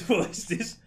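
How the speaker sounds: laughing through it; shocked, half laughing; laughing disbelief. Retold or imitated. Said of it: "Even he's like".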